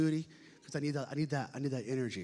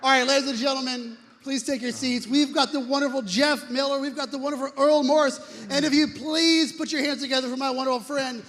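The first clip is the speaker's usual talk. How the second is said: booming high arena announcer; announcer call; 12 semitones higher, 15 dB louder than usual. Acted. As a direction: boom the name out like a ring announcer. Emcee introduces host on stage.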